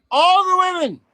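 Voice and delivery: loud, high insisting; shouting. Real, not acted. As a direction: yell the point. Arguing, raised voice.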